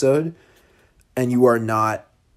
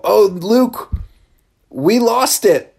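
higher, louder, slowing mock dismay; mock dismayed podcast hosts; 8 semitones higher, 5 dB louder, slower than usual. Hosts tell Luke the file is lost, mock dismay. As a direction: play the hosts breaking bad news gently. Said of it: "they're gonna go".

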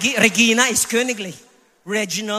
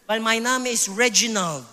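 ordinary delivery

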